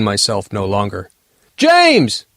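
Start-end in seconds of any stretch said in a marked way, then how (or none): 1.6-2.2 high, loud yell for James; shout. Acted. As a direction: scream his name in panic. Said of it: "I shouted".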